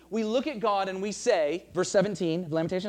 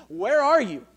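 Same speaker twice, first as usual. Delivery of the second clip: louder, higher cry to heaven; anguished cry; 4 semitones higher, 8 dB louder than usual. Pretend. Preacher voicing Jesus crying to heaven, anguished. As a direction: call out, forsaken.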